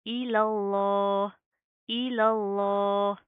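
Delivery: इलल्लाह with a thick, full lam, slow and loud; heavy Allah. Shown as a demonstration. Teacher recites Allah with the heavy lam for the class. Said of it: "लफ्ज़ अल्लाह के लाम को हम मोटा पढ़ेंगे".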